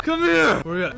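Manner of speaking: high shouted order; hammed-up high yelling; mock furious yell. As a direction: shout as you give chase. Mock rage, attacking friend.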